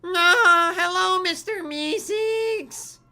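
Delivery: very high, slow, drawn-out cartoon greeting; cartoon helper voice, very high; Mister Meeseeks voice. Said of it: "Is that what he sounds like?".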